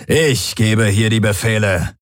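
indignant commander putting someone down; snorting commander. A narrator's reading. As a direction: snort it with authority. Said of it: "schnaubte der Feldherr". (empört schnaubender Feldherr)

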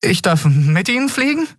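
high, breathless-with-hope question; eager young lad's high voice; excited boy. A narrator's reading. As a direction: ask it high, thrilled and hopeful. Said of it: "Miko zitterte vor Aufregung".

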